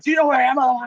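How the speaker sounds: high, loud mock rage; strained, high-pitched angry impression; confrontational imitation. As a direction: imitate an aggressor right up in someone's face. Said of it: "he got right up in my face".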